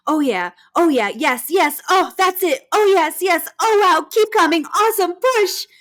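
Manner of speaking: high, loud cheering that keeps building; zealous encouragement. Retold or imitated. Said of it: "she was super zealous during the pushing phase".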